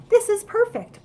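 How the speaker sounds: high, loud and thrilled; excited little squeal of delight. A narrator's reading. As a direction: cheer it out, pleased with himself. Delighted at his plan.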